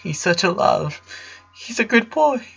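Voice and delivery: high, tearful pleading; crying painfully. A narrator's reading. The father sobs over the sick boy, high and tearful. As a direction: sob the question out. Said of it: "Howard cried painfully".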